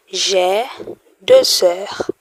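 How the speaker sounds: teacher models the French answer. Shown as a demonstration. Teacher models J'ai deux sœurs for the class. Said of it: "How many sisters do you have".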